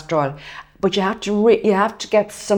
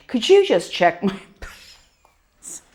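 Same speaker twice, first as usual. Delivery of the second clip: coy laughing request; 2 semitones higher, much slower than usual. Retold or imitated.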